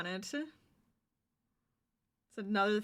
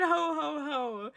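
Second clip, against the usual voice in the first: mock Santa ho ho ho; 3 semitones higher, 4 dB louder, slower than usual. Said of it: "I sounded like Santa".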